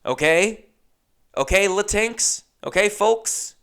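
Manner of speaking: mock placating. Real, not acted. Mock addressing the audience.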